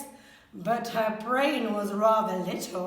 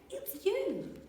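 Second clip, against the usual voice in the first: soft laughing reply; 8 semitones higher, 8 dB quieter than usual. A narrator's reading. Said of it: "they laughed and said".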